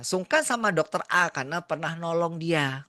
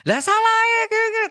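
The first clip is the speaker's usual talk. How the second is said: much higher, louder, fast indignant ranting; irritated doctor; 9 dB louder, faster than usual. Retold or imitated. Angry doctor explaining himself to the nurse, indignant and loud. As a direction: rant back, defensive and annoyed.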